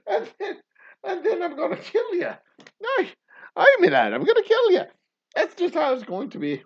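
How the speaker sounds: high-pitched, hammed-up Irish threat; bad Irish accent. Father promises to kill the kidnapper, in a high bad Irish accent. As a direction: push the mock-Irish brogue up high and comical. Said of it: "affect a bad Irish accent".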